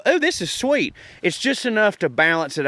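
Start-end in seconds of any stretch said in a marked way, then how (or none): 0.0-0.9 high, airy "oh, this is sweet" as an imagined eater; a lightly voiced surprised taster; mock surprised taster. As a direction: imitate someone tasting too much sugar. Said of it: "where you go".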